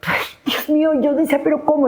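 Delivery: angustia. Retold or imitated. Encerrada mientras la esperan.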